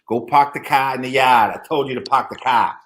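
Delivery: barked Boston accent, "car" as cah; bossy Boston accent. Shown as a demonstration. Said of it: "Boston has the thickest Boston accent".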